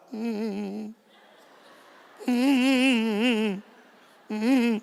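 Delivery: soft whimpering crowd hum; mock sobbing hum. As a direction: moan along like a moved crowd. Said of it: "Sabi ng mga tao".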